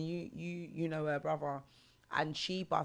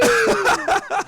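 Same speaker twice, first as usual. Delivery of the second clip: put-on loud high drunken laugh; whooping laugh; 16 dB louder than usual. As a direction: cackle like a show-off drunk. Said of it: "retold drunk cartwheels".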